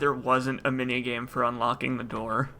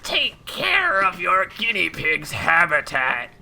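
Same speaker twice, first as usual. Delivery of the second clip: slow, eerie monster murmur; creepy Taken voice; slower than usual. Pretend. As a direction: drag it out like a ghoul.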